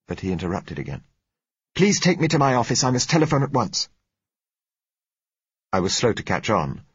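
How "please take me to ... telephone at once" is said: high, raised urgent request; urgent demand. A narrator's reading. Doctor interrupts the patient to reach a telephone, urgent. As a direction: cut in urgently, pitched up.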